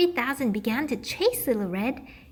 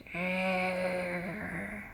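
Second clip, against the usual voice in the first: low growl; 7 semitones lower, 9 dB quieter than usual. Acted. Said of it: "said a growly voice".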